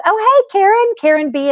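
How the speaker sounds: light up at seeing a friend arrive; excited greeting. Real, not acted. Greeting Karen as she joins the livestream, excited.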